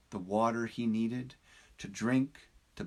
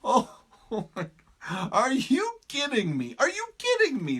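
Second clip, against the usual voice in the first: much higher, louder laughing disbelief; hysterical laughter; 12 semitones higher, 7 dB louder than usual. Acted. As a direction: laugh off a crazy idea. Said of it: "laughed hysterically".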